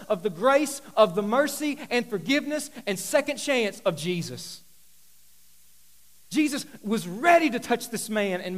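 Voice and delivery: loud, driving sermon build; impassioned pulpit delivery; full-force preaching. Acted. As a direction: preach it hard to the room.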